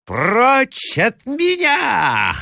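high, drawn-out cry of exasperation; desperate cry. A narrator's reading. Hostess driving her rival away, crying out in despair. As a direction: cry out high, at the end of your tether. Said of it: "закричала Марья Александровна, доведённая до отчаяния".

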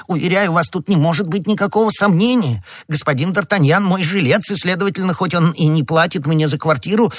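high-pitched, insistent little man; flustered high voice pressing the point; agitated, pleading prisoner. Acted. Bonacieux argues his case.

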